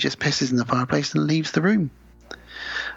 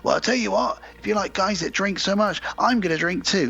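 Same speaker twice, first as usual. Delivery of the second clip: higher-pitched acted retort; arguing boyfriend; 7 semitones higher than usual. Fella arguing with his girlfriend over drink, mock argumentative. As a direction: play the fella in a tiff, pitched up. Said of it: "and he says".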